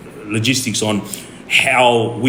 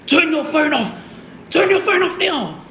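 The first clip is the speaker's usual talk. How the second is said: much higher shouted order, repeated; cop yelling. Retold or imitated. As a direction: yell the command at the wife. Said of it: "he yelled at my wife again".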